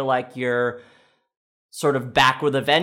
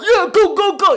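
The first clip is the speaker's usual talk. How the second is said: cheering; 10 dB louder than usual. Acted. Mock cheering for the team.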